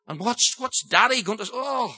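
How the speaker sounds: ordinary delivery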